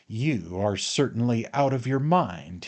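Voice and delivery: usual speaking voice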